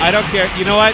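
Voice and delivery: loud, high-pitched outburst; defiant shout. Real, not acted. Host defending his joke over a sound effect, defiant shout.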